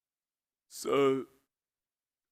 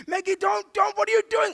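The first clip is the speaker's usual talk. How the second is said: very high, loud frantic shout; hammed-up shrieky outburst; panicked exasperation; 8 dB louder than usual. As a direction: demand an answer in utter alarm. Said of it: "We're panicking at the robots".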